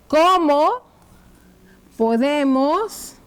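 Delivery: drawn-out words with very long gaps; slow dictation. Shown as a demonstration. Teacher writing the caries question for pupils, slow dictation. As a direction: say one word, wait while writing, say the next.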